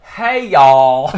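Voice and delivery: hammed-up country greeting. Joking about the actress's accent, loud mock country holler. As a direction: belt out an exaggerated folksy hello. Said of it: "she's not like".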